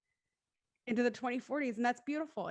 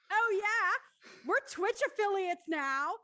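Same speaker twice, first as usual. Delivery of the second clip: excited; 9 semitones higher, 3 dB louder, slower than usual. Real, not acted. Sudden excited announcement.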